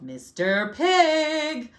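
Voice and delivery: loud call, climbing very high and long. A narrator's reading. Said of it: "I called".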